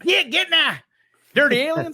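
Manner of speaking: high, loud cackling yokel; hillbilly shoo. Shown as a demonstration. Shooing spirits in put-on voice.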